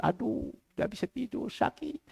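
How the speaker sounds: soft moaning over pain; imitated pained complaint. Sick person answering about recovery, pained. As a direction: quietly act out a sufferer's "aduh".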